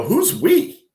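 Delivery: high, sharp mock challenge; imitating a snappy retort; retold pushback. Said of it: "I literally said that".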